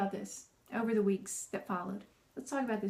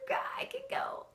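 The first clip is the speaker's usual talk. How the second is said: high, strained, held-in exasperation; acted frustration squeezed up high; frustrated; 6 dB quieter than usual. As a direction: groan it high without shouting. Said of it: "totally frustrated and I feel myself going".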